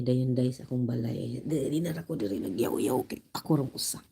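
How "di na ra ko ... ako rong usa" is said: ordinary delivery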